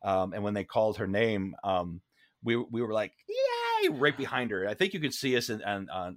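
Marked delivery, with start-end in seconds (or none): 3.3-3.9 very high-pitched puppet "yay"; Muppet cheer. Retold or imitated. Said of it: "Like, like, Muppets".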